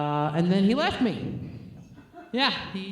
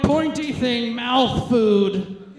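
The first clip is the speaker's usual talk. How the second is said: mock caveman naming the fork; groping for words; 3 semitones higher, 4 dB louder, much slower than usual. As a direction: fumble for the word like a caveman. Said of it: "I'm like".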